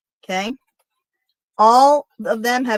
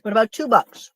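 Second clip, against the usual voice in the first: chewing while talking. Real, not acted. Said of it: "I'm sorry for my chewing".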